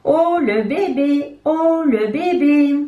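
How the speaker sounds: high, slow sing-song mockery; little hens mocking Coco's baby photo; teasing. A narrator's reading. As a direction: taunt a baby sister lightly. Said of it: "Ce n'est pas gentil de se moquer".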